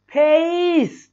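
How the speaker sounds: फेस drawn out very slowly; फेस held long; slow demo. Shown as a demonstration. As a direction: stretch the vowel in फेस. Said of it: "और एक होता है".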